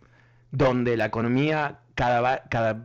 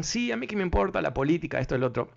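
dismissive quote; 6 semitones higher, faster than usual. Retold or imitated. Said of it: "para aquellos que dicen".

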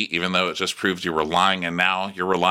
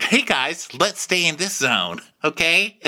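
higher-pitched mimicked pep talk; Asher; 7 semitones higher than usual. Asher telling the other guys, raised mimicking voice. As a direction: lightly imitate someone coaxing the group. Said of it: "he's like".

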